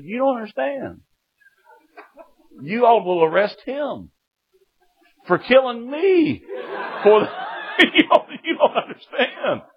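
high, laughing, frantic protest; panicked plea. Retold or imitated. Jailed son panicking that his dad will kill him. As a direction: plead in terror, half laughing.

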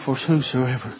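low, soft mumble; retold mumble. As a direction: mumble it under the breath, imitating him. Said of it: "He mumbled".

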